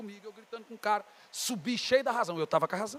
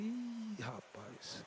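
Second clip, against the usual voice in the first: dismayed aside; 13 dB quieter, much slower than usual. Retold or imitated. Hearing the caller knows he is God's man, dismayed aside. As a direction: let the voice sink in dread.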